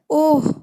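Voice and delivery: high, loud "oh" of sudden hurt; cry of pain. Shown as a demonstration. Teacher shows students how a hurt man says it, pained cry. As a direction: yelp "oh" as if just struck. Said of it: "जिसको चोट लगती है वो कैसे कहता है".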